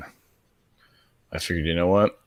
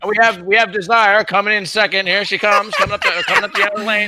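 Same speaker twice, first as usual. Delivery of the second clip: fast, higher race-caller voice, building; horse race announcer; 13 semitones higher, 6 dB louder, faster than usual. Pretend. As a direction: call the race like a track announcer. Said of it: "sounds like a horse race".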